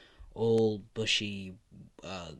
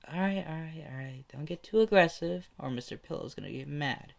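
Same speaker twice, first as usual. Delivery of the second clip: lightly voiced child, pitched up; raised-pitch, placating kid voice; little kid; 6 semitones higher than usual. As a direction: play a kid giving a mock warning, higher. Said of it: "the little kid says".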